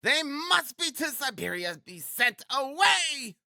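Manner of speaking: very high, outraged landlady shout; landlady yelling. A narrator's reading. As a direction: yell the demand high, dragging out the end. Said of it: "yelled Amalia Ivanovna".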